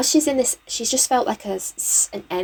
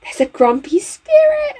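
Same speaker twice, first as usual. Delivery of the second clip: high, loud, thrilled announcement; excited discovery; 5 dB louder than usual. Real, not acted. Player tells viewers a spirit has appeared, excited. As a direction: voice jumps up with excitement.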